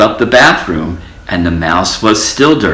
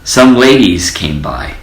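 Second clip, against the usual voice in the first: usual speaking voice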